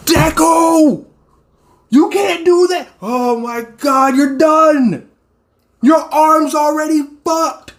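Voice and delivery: high, yelling at the screen in alarm; panicking for the hero. Real, not acted. Yelling at injured Deku.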